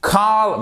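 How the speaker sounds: "Call" high and isolated; stressed syllable alone. Shown as a demonstration. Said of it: "vous pouvez même partir que du".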